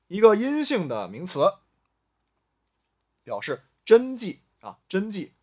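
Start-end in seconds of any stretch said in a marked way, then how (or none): none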